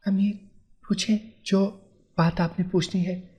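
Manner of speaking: wife asks nervously, afraid of the question; fearful. A narrator's reading. Frightened wife answers husband timidly. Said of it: "वो डरते डरते बोली".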